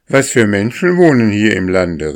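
put-on a boy calling from above. A narrator's reading. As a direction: shout the question to someone below. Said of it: "rief er".

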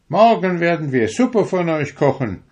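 put-on high, loud boyish threat; high boy warning the birds; threatening boy. A narrator's reading. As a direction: scold the poultry like a cheeky boy. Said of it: "sagte Friedrich".

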